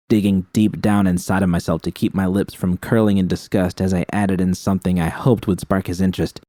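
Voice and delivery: plain reading voice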